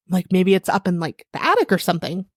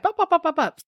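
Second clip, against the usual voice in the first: high bup noises; silly high bups; 9 semitones higher than usual. Retold or imitated. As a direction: chirp bup five times, much higher. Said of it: "I just kinda said".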